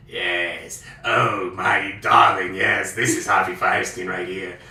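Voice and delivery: low, slow drawn-out theatrical voice; Harvey Fierstein impression. Host imitates Harvey Fierstein, low and slow. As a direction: stretch darling out, low and grand. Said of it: "more of that".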